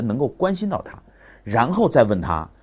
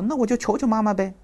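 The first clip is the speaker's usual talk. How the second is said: put-on much higher little-girl reply; 13 semitones higher than usual. Neighbor girl answering the father, high girlish voice.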